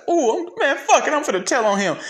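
squeaky mocking voice of a careless woman; mocking high voice. Mocking her as she decides to tell on him. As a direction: mimic her shrugging it off in a high mock voice. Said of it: "you're just sitting there looking at your foot like".

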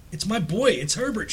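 high, pleading mother's cry. A narrator's reading. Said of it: "she cried, struggling mechanically".

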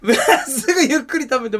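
laughing. Real, not acted. Laughing at friend's thanks.

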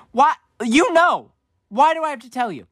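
high, loud protest; exasperated protest. Acted. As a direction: protest in exasperation at a pointless question. Mock protest at parents' question.

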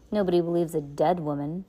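plain reading voice

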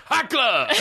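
high mock yell of a battle cry; imitating a rowdy screamed catchphrase; retold party scream. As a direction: holler it like a revved-up drunk. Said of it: "kinda, like, screams".

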